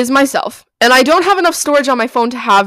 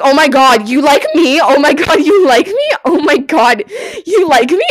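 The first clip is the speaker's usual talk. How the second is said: high, loud mock-thrilled gushing; gushing excitement; 4 semitones higher, 5 dB louder than usual. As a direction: gush over a crush, rising higher each time. Retold thrilled reaction to crush.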